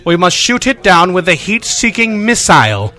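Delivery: a light British film-villain voice, said mildly; lightly voiced, grandly stating the order; a hint of a stern British commander announcing the plan; Malcolm McDowell impression. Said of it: "a fairly good Malcolm McDowell impersonation".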